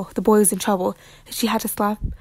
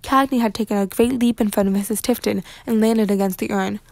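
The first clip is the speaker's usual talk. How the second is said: usual speaking voice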